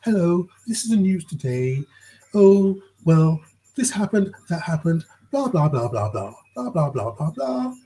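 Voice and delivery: imitating a newsreader's clear, wake-up tone. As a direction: announce it crisply like a TV news bulletin. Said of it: "they talk in a very clear way".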